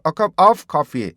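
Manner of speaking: "a cup of coffee" with "of" loud and stretched as 어; mistaken timing. Shown as a demonstration. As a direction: throw the timing off with a long "of". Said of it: "이러니까 발음이, 타이밍이 안 맞는".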